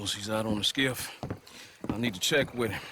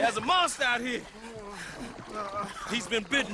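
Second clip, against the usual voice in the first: calling for help; 8 semitones higher than usual. Acted. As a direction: shout for help, desperate for someone to hear. Calling dock for help, friend bitten.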